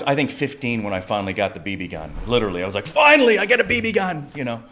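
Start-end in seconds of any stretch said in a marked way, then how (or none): 2.9-4.3 high, loud burst of relief; acted-out elation. Teen finally getting a BB gun, excited outburst. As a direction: burst out with long-awaited joy. Acting out his teenage delight.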